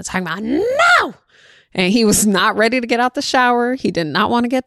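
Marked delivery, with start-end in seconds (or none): 0.4-1.1 high, loud, wailing no; a kid screaming no at his mom; child yelling no. Retold or imitated. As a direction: shriek it like a furious toddler. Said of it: "this child was yelling at his mama".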